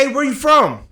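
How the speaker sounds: high challenging "where you from"; quoting the skater kid. Retold or imitated. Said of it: "He says".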